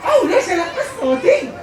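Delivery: high-pitched, defiant retort; affronted, high-voiced protest; indignant. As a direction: refuse to be hushed, pitch shooting up. Refuses to lower her voice.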